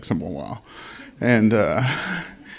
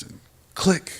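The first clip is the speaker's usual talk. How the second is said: higher, sharp mock click; sharp click sound; 9 semitones higher than usual. A narrator's reading. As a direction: snap out the click like a misfire. Gun misfires again.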